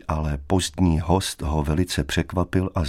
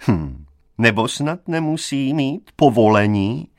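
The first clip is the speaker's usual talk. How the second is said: higher-pitched sneering question; mock him to the room; coarse mockery; 5 semitones higher, 3 dB louder than usual. A narrator's reading. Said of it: "zněl hrubiánský posměch". (hrubiánsky posměšně)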